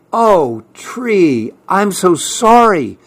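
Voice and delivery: a touch of high, pitying sorrow; gulping sorrow. A narrator's reading. Fletcher apologises to his bare tree, gulping sorrow. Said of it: "gulped Fletcher".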